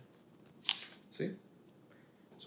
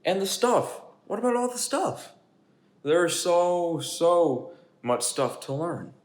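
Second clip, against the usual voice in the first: much higher, fretful pigeon voice; anxious pigeon; 7 semitones higher, 5 dB louder than usual. Acted. Pigeon panics about learning too much, much higher. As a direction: panic about all the stuff.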